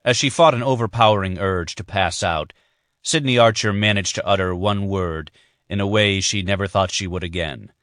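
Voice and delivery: ordinary delivery, nothing marked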